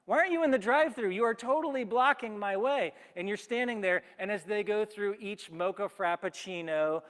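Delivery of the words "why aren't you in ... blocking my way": louder, higher, fast irritated complaint; irritated rant. Retold or imitated. Waiting customer rants at the person ordering twelve drinks, fast irritated complaint. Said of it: "You're like".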